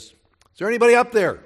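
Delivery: shouting up high and loud from the cliff; calling out. Retold or imitated. Man hanging off a cliff calls up, shouting. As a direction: yell up for help, high and strained. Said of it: "So he calls out".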